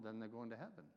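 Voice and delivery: ordinary talk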